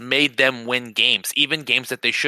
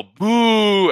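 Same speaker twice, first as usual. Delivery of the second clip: crowd boo; 8 semitones higher, 6 dB louder than usual. Retold or imitated. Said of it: "They go".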